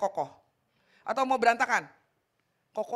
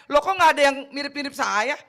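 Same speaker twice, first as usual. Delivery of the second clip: a bewildered Adam searching for his match; puzzled Adam; 4 semitones higher, 10 dB louder than usual. Retold or imitated. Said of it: "Adam lihat gini kan".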